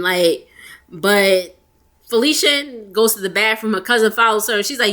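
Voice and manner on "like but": ordinary talk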